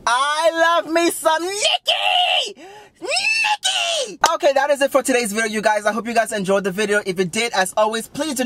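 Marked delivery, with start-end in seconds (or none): 0.1-4.2 high, drawn-out squealing shout; fangirl scream. Real, not acted. Fan screams her love for [name], high squealing shout.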